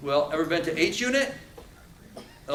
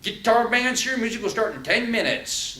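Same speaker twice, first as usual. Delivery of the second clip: prison intercom announcement; 8 semitones higher, 5 dB louder than usual. Retold or imitated. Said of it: "They do the little button".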